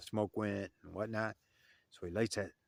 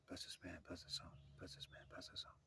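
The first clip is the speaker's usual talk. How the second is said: prayer fading to a hushed whisper, much softer; 3 semitones lower, 18 dB quieter than usual. Retold or imitated. Narrator praying in secret in the elder's room, fading into a whisper. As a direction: murmur the blessing under your breath.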